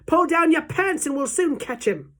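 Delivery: high, loud holler; urgent high-pitched shout to a workmate; shouted instruction. A narrator's reading. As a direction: call it out over the commotion. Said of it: "someone else shouted".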